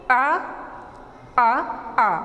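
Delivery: "आ, आ, आ" drawn out as the English sound; English vowel sound demo. Shown as a demonstration. Teacher demonstrates the English vowel to students, clearly repeated. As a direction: repeat "आ" slowly and clearly for the class. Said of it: "English में अगर हम इसको करेंगे".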